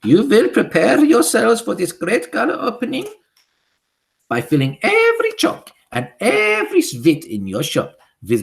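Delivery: high, loud witch voice with a foreign lilt; high imperious witch decree; Grand High Witch. Acted. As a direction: command the witches like a tyrant queen. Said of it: "continued the Grand High Witch".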